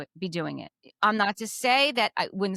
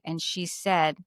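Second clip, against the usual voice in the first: ordinary talk, nothing marked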